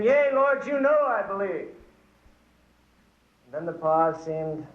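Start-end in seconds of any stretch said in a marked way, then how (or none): 0.0-1.7 high, hurried yea Lord; eager quick answer. Acted. Man dangling on the cliff answering the Lord, eager and high. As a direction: blurt it out eagerly to God.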